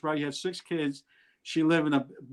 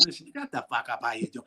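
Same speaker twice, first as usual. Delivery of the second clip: muttered inner grumble; 4 semitones lower, 5 dB quieter than usual. Retold or imitated. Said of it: "I'm saying".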